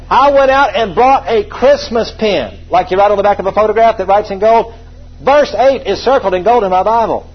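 emphatic pulpit delivery; full-force preaching. Real, not acted. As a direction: proclaim it to the whole church. Preacher proclaims to congregation.

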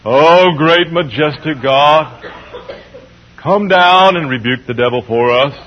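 mock-pious, overly dignified prayer voice; mealy-mouthed prayer. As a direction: parody a prim churchgoer praying politely. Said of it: "mealy-mouthed prayer".

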